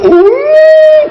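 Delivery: high-pitched mock wail; imitated crying. Preacher mimicking how his father-in-law cried, mock wail. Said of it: "mamak teriak macam tu".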